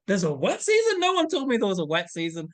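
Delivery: high, loud, incredulous outburst; disbelieving outcry. Retold or imitated. Retold shock at wet season.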